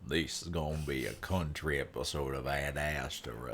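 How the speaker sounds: put-on cowboy accent. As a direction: play a low-voiced Southern cowboy. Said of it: "break out my cowboy".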